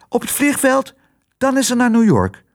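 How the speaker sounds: hoog hondjesstemmetje; poedeltje Happy. Acted. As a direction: praat hoog, als een klein poedeltje. (hoge poedelstem)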